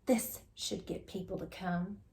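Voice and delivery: hushed whisper instead of a cry; secretive plotting whisper; cried. A narrator's reading. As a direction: whisper it with a sly plan. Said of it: "Rebecca Estelle cried".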